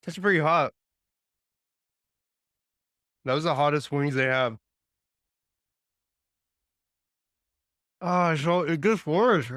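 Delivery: groaning through the burn; mouth burning from spicy food. Real, not acted. Eating atomic hot wings.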